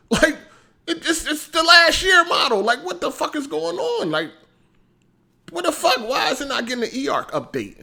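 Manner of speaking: high, loud exasperated rant. Real, not acted. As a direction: throw your hands up in disbelief. Ranting about missing update.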